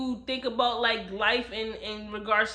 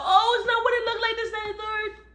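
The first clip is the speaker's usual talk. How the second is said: much higher, defensive mother voice; mother caught out; 10 semitones higher than usual. Retold or imitated. Mother caught in bed with the uncle, flustered high excuse. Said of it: "So the mother comes out".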